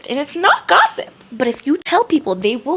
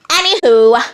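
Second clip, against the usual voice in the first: loud wrap-up; 5 semitones higher, 6 dB louder than usual. Acted.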